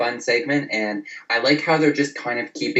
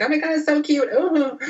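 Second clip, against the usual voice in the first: put-on much higher squealing girly voice; Summer impression. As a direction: gush over the puppy in a much higher put-on voice. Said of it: "She's like".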